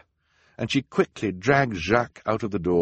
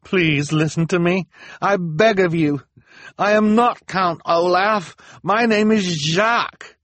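much higher, louder begging; desperate pleading; 12 semitones higher, 8 dB louder than usual. A narrator's reading. Jacques begging the townspeople to listen, high and desperate. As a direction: beg them to believe you. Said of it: "the man on the platform cried".

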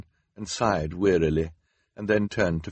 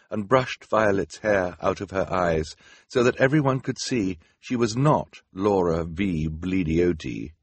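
plain reading voice

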